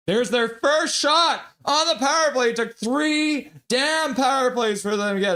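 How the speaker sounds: finally, a shot, let it out; excited. Real, not acted. Hockey streamer cheering his team's first power-play shot, excited.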